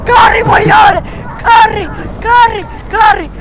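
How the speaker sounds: high frantic yells; startled shouting. Real, not acted. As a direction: yell after a near miss. Fulmine la sfiora, urla. (urla spaventate dopo il fulmine)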